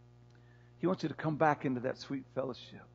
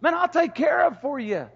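all that other stuff said high and louder; God's reassurance; 10 semitones higher, 8 dB louder than usual. Acted. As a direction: lift it high, easy promise. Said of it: "Then he says".

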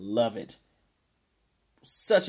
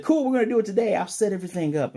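loud, high burst of enthusiasm; retold gleeful announcement; excited eagerness; 9 semitones higher, 8 dB louder, faster than usual. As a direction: jump in eagerly, pitched way up. Frankie's eager reply once he agrees.

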